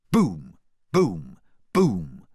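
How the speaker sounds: loud, punched sound effect; forceful falling thuds; booming impacts. A narrator's reading. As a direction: hit each one like a battering ram. Battering ram striking the door.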